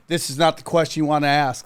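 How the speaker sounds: imitating stern agents. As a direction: shut the question down like a government spook. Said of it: "That's what they did".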